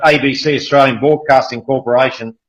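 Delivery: ordinary talk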